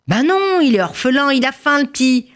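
put-on high-pitched protest on the boy's behalf; object, pitched well up; protesting defence. Man defends the boy to woman. (protestation aiguë)

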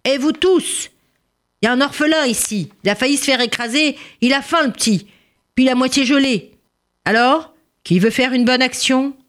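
high-pitched appeal to a whole room; calling for silence. Acted. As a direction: address the tavern crowd, pitched well up. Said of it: "pour obtenir le silence". (harangue aiguë à toute l'auberge)

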